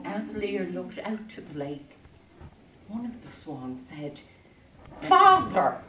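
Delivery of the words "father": high, loud cry to a parent; a swan's sudden plaintive call. Acted. Enchanted swan calls to father across the lake, high cry.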